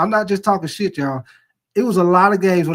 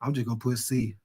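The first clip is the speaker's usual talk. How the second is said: much softer, lower, giving up on the answer; resigned guessing mumble; 8 semitones lower, 12 dB quieter than usual. Retold or imitated. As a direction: mutter it under your breath like a shrug. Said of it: "So what you say?".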